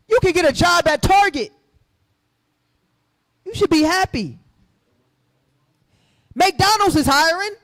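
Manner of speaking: high, loud, mocking sing-song of a dismissive voice; preacher mocks those who say get over it, sarcastic high mock voice. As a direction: sneer it like a smug official telling them to be grateful. Mocks what society tells them.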